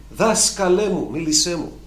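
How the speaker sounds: earnest prayer; pleading prayer. Pretend. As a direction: call out to the Master, longing. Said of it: "Κάθε μέρα ανοίγεις τη γραφή".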